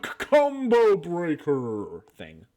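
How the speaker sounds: slow booming fighting game announcer. Retold or imitated. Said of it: "That sounded exactly like the announcer".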